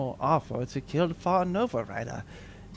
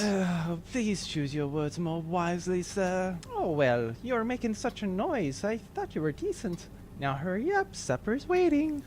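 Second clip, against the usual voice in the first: quiet mother voice; 5 semitones higher, 7 dB quieter than usual. Acted. Mother and servant quietly reply.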